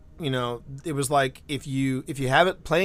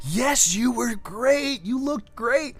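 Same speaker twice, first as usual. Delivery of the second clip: slow, gentle, a touch higher; tender dad; 8 semitones higher, slower than usual. Retold or imitated. [name] praising his daughter after ballet, slow and gentle.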